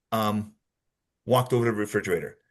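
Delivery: halting, low fillers with pauses; change of flow. Shown as a demonstration. Teacher replaying a suspect's kitchen story, flow broken by hesitation. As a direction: stall on the ums before resuming the alibi. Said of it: "I had that change of flow".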